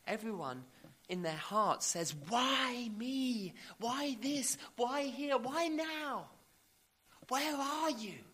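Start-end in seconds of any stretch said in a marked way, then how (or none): 2.1-8.2 high, drawn-out pleading wail; anguished cry. Acted. Preacher voices every sufferer questioning God, anguished cry. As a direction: cry out each question to heaven, pitched high.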